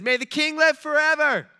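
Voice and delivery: high, loud mock-regal hail; loud acclamation. Freaked-out Nehemiah hailing the king, loud and high. Frightened servant hails the king.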